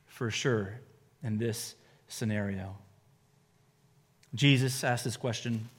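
ordinary talk, nothing marked

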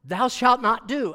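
loud, slow, firm commandment; stern command. Retold or imitated. Parent forbidding children, stern loud command. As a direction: lay down the law sternly.

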